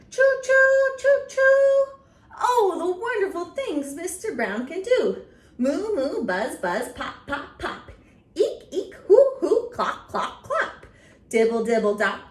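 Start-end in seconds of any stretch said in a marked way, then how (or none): 0.1-1.8 high, loud choo choo like a train whistle. Shown as a demonstration. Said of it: "He can go like a train". 5.6-10.8 animal noises. Shown as a demonstration. Animal sounds from Mister Brown book.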